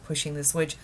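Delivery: ordinary delivery, nothing marked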